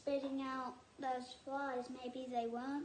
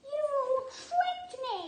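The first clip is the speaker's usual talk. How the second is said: much higher, loud hammed-up yell; 12 semitones higher, 10 dB louder than usual. A narrator's reading. Dingo after slurping the stew, yelling in panic. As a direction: cry out like you've been poisoned. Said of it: "he yelled".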